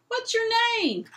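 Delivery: loud, high shout upward; lion shouting up to the giant; bawled. Acted. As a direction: holler it up to someone far above. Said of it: "he bawled up at the giant".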